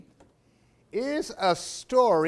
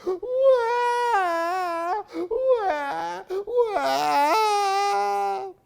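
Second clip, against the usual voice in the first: high, loud mock crying; louder wailing; 6 dB louder, much slower than usual. The tree wails louder at the bear, high and loud. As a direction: wail even harder. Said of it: "the tree just wailed even louder".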